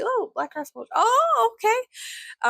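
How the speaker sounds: high-pitched mock relief; squeaky relieved surprise. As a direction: hit a high squeak of realisation. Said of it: "And I see".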